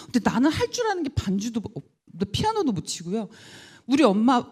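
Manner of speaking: ordinary delivery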